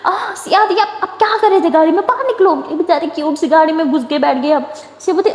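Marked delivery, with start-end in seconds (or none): 0.0-2.5 high, startled gasp and jumpy words; startled fright. Acted. Sister startled by cousin leaping from trunk, high frightened yelp. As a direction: jump out of your skin, then scold. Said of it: "وہ زور سے ڈر جاتی".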